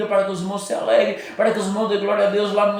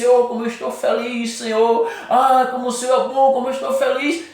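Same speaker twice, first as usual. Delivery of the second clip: mock cheerful prayer, pitched higher and louder; fake happy prayer; 7 semitones higher, 4 dB louder than usual. Preacher mocks a fake joyful prayer, hammed-up cheer. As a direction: put on a showy joy you don't feel. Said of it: "Não adianta eu dizer".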